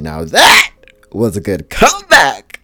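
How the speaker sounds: loud, high, hammed-up admiration; retold shout of approval; exclaimed praise. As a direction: burst out with emphatic praise. Loudly retelling praise of a comeback.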